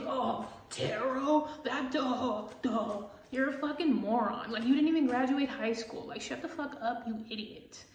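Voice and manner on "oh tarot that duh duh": slow, dopey take on the skeptic; hammed-up dimwit voice; dumb mocking voice. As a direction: drag it out like a slow-witted mocker. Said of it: "you just think".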